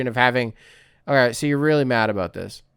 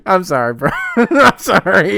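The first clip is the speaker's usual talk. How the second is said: higher and louder, slowed by laughter; sorry said through real giggles; laughing apology; 3 semitones higher, 5 dB louder than usual. Real, not acted. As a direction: apologise while cracking up. Laughing while apologising to Cooper.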